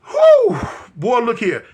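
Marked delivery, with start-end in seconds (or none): 0.0-0.6 high loud whistling whew; loud high whew of relief. Retold or imitated. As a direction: blow out big relief. Relief after running.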